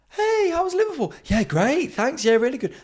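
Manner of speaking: very high, loud chirpy greeting; high loud workmate voice; chirpy coworker. Retold or imitated. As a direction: squeak it like a bright office colleague. Colleagues greeting him at work.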